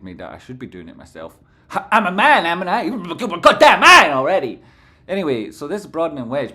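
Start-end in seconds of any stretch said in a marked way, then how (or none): 1.7-4.6 loud blustering tough-guy voice; hammed-up manly bellow; mock macho shout.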